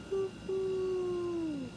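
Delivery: high hooting owl call. Pretend. Reading to children, high owl hoot. Narrator voices owls calling.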